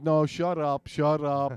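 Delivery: put-on loud whiny kid voice; bratty kid yelling. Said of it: "like that kid did on Halloween".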